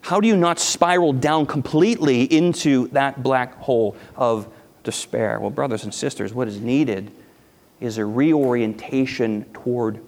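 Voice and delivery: ordinary delivery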